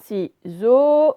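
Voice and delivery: "Ciseaux" split, "seaux" loud and held; drawn-out final syllable. Shown as a demonstration. Teacher correcting the exercise, final syllable drawn out. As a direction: stretch the O at the end of "Ciseaux". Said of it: "on l'entend là aussi".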